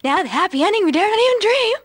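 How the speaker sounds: quick, high, climbing delivery; exaggerated upward tune, ending high; rising pitch. Shown as a demonstration. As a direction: race up the scale to the last word. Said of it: "going up and up and up".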